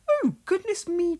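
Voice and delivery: high, fluttery surprised lady's voice; a flustered village lady caught off guard; startled Miss Hubbard. A narrator's reading. Miss Hubbard gasps at the postman in shorts.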